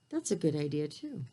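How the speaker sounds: ordinary delivery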